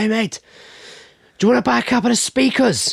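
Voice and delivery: put-on high, uncertain Irish accent; high-pitched mock Irish hawker. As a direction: imitate a van seller in a shaky attempt at Irish. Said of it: "usually an Irish accent".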